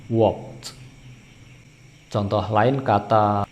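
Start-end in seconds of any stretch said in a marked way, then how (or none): 0.1-0.8 "Walked" as wokt, falling; correct one-syllable walked. Shown as a demonstration. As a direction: release a separate t after k in "Walked". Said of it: "tapi walked".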